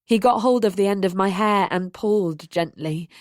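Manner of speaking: ordinary delivery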